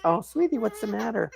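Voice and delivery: put-on high, laughing baby talk; cooing nurse. Mom imitating hospital staff cooing at her, high and laughing. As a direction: coo like a nurse fussing over a baby. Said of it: "the hospital staff was".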